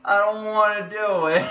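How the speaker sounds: loud, high-pitched protest; whiny refusal. Real, not acted. Still refusing the scary show, loud high whine.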